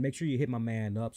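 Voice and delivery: normal talking voice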